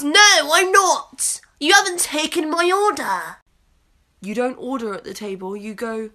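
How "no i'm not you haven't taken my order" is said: much louder, higher whiny outburst; indignant customer. Retold or imitated. Waitress imitating customer demanding service, loud whiny outburst. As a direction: throw a mock customer tantrum. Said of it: "He goes".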